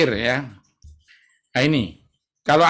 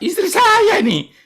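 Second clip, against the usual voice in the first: high, louder proud reply; emphatic answer; 4 dB louder than usual. Retold or imitated. Patient proving memory to doctor, proud high answer. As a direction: proudly show you remember.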